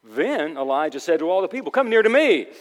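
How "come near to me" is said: high, loud summons; calling out. Acted. Elijah calling all the people near, loud summons. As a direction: beckon a crowd with a raised voice.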